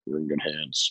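deep, soft, smooth pitchman voice; Allstate guy voice. Retold or imitated. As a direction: purr it like the insurance ad guy. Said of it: "in his voice".

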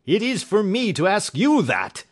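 loud, high-pitched retort; furious shriek. Acted. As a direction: shriek back at your accuser. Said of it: "he shrieked".

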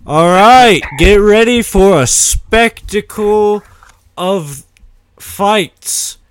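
booming, high, drawn-out ring announcer; fight announcer. Acted. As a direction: shout it out to a whole arena, stretching the names. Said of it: "The announcer, the announcer, uh, says".

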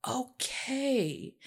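drawn-out, quiet agreement; dawning acceptance. Retold or imitated. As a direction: let it sink in, softly. Acting out her reassured response.

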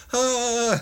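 high, loud mock yelp of horror; shocked cry. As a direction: cry out as if recoiling, storytelling. Retold recoil in shock.

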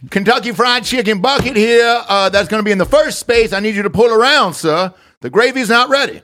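put-on Old South accent. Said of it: "that's what I want everybody to be like there".

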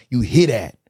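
ordinary delivery

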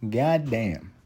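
normal talking voice

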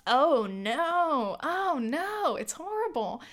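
high, drawn-out "oh no" of disgust; mock disgust. Tasting the pea protein drink, high disgusted groans. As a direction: recoil from the taste. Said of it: "and I'm like".

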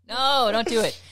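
ordinary talk, nothing marked

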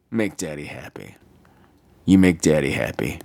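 very low, creepy purr; mock-sinister low pleading; creepy daddy voice. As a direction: drop the voice right down, creepily coaxing. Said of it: "I just creeped myself out".

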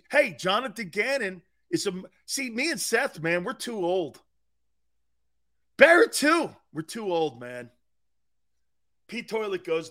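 ordinary talk

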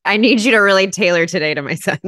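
father's mock request. Father asking the priest about the service, jokingly demanding. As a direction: play a dad putting in a special order, loudly.

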